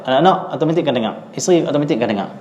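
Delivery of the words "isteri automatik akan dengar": ordinary talk, nothing marked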